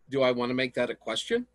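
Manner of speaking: "Do I wanna make that a question?" lifted at the end, fast; rising question tune. Shown as a demonstration. Teacher demonstrating question intonation to class, rising tune. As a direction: lilt the voice up on "question". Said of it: "lilt my voice at the end".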